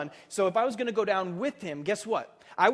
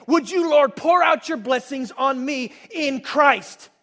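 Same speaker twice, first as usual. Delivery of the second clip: loud, soaring and higher, full of joy; bold declaration; 5 semitones higher, 10 dB louder than usual. Pretend. Believer boldly telling God he is blameless, loud and joyful. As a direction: shout it out like you've just found the key. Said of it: "the people that say".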